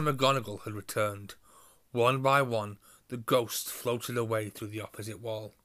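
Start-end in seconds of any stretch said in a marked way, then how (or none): none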